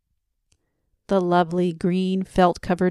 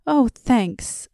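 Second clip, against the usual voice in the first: grateful burst, pitched higher; excited thanks; 6 semitones higher, 4 dB louder than usual. A narrator's reading. Young man thanking the old man for the needle, excited. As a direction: thank him all at once, delighted. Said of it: "said the young man".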